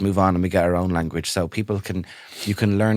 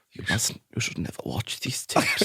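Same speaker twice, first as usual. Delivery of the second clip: a hint of grave German accent; soft, solemn warning, lightly imitated; Werner Herzog; 7 dB quieter than usual. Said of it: "he says to her".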